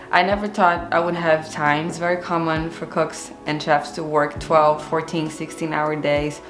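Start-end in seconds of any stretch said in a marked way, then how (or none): none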